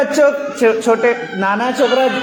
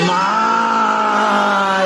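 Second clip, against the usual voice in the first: buffalo moo; much slower than usual. Pretend. Child mooing like a buffalo for the teacher. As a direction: stretch it like a lowing buffalo. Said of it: "भैंस कैसे आवाज निकालेगी?".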